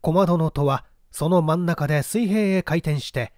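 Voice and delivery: plain reading voice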